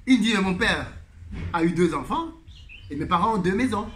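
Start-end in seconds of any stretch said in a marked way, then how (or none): none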